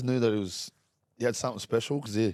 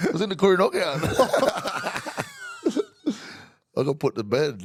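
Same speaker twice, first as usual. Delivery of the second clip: high giggling through the words; laughing; 9 semitones higher, 4 dB louder than usual. Real, not acted. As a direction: laugh while telling it. Laughing recalling knockout.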